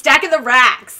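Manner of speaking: boastful, punched-out catchphrase; loud, playful boast; loud mock brag. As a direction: crow it like a big flexer. Bragging chant read from a comment.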